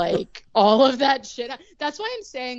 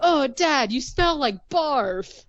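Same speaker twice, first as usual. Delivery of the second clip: put-on high, slow kid whine about the smell; mock kid, grossed out; whiny kid; 6 semitones higher, slower than usual. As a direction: drag it out like a disgusted little boy. Kid teasing dad.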